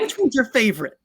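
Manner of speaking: put-on high, thrilled old lady voice; eager old lady. Fan lady quizzing him at work, high and eager. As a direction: ask it like a delighted elderly fan. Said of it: "She, she goes".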